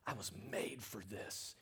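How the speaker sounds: very soft, low inner murmur. Retold or imitated. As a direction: say it quietly to yourself. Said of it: "you just say to yourself".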